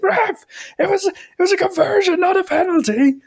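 very high, squealed objection through laughter; hammed-up high-pitched outrage; mock sideline protest. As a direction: squeal at the ref as an imitated spectator.

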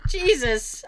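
normal talking voice

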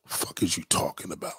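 soft unvoiced scornful whisper; whispered jab. Real, not acted. Mocking a rival on stream, in a scornful whisper. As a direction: breathe it out with scorn.

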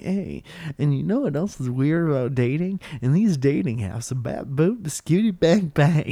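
lightly mocked stand-up delivery; stand-up comic. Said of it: "somebody's up there like".

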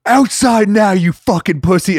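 high, loud challenge to fight; mock furious shout. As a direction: bark the heckler outside. [name] confronting heckler.

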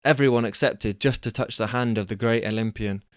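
ordinary delivery

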